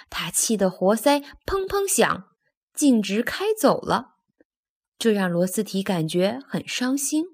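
ordinary delivery

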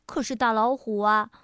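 ordinary delivery

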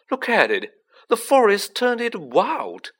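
high, loud marvelling; thrilled and loud, climbing in pitch; delighted. A narrator's reading. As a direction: show it off to a friend in glee. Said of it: "said Ron delightedly".

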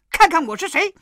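Monkey King yelling his reveal; shout. Acted. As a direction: crow it out, unmasked. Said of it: "叫道".

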